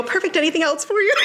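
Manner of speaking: high chirpy barista, laughing; drive-thru speaker. Retold or imitated. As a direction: automatic barista voice breaking into laughter. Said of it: "I go".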